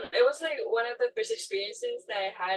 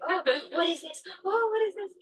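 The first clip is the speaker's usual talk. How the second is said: higher-pitched imitation of excited kids; 9 semitones higher than usual. Little kids crowding round the touch pool, excited. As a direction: mimic children's eager curiosity. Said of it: "like, so excited".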